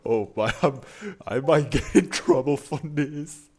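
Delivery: loud, amused worry, breaking into laughter; laughing alarm. Real, not acted. Streamer might get in trouble for this, laughing alarm.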